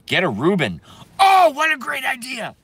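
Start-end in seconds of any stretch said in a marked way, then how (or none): 1.2-2.5 sudden high-pitched whoop of delight; delighted exclamation. Real, not acted. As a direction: burst out much higher, thrilled. Delighted by a viewer's sandwich suggestion.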